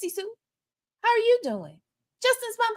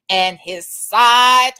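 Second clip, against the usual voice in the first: outraged shout; 3 semitones lower, 6 dB louder, slower than usual. Acted. Builds to loud outraged reveal.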